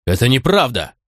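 understated outraged denial; a touch of fury; mildly furious. A narrator's reading. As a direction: deny it indignantly, without shouting. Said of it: "Я в ярости вскочил на ноги".

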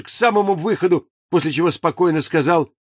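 ordinary delivery, nothing marked

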